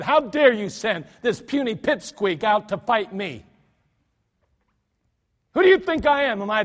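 indignant giant, building to a loud outburst; angry Goliath. Acted. Goliath mocking David, building to an angry roar. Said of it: "He was angered at David".

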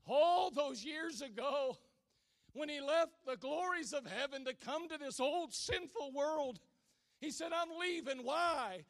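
loud, high fervent preaching; preaching at full force. Acted. Preacher building to a fervent climax, loud. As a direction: drive it home to the congregation.

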